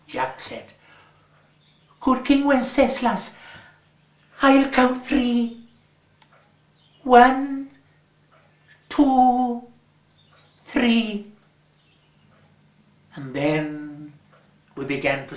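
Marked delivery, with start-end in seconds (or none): 2.0-11.3 higher, deliberate count-in; lightly voiced boy counting one, two, three. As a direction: lead the others in like a small bandleader. Jack counts the carollers in.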